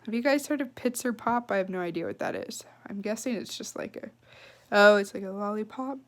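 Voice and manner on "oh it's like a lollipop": ordinary delivery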